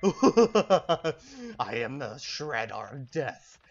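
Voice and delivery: laughing loud, then slow and grand; hammed-up doom lord announcing himself; mock villain boast. Gamer to viewers, hammed-up villain boast.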